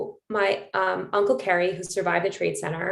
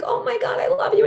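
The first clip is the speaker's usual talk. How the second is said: much higher, thrilled outburst of love; overjoyed gush; 3 dB louder than usual. Retold or imitated. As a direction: squeal it like a mother moved to joy. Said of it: "And Nancy was like".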